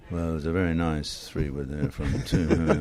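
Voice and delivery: slow, low, dreary commentary; mock a dull analyst's drone; understated, lifeless golf commentary impression; boring analyst. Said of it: "nothing worse when an analyst goes".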